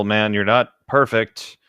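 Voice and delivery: ordinary talk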